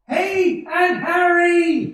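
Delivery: high, loud, slow hail; joyful greeting. Acted. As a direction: welcome her with delight. Greets aunt arriving at door.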